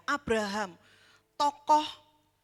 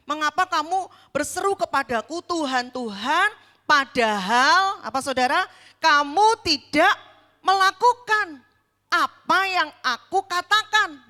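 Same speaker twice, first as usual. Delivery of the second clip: forceful rebuke; 3 semitones higher, 9 dB louder than usual. Acted. Preacher repeats Jesus' rebuke forcefully.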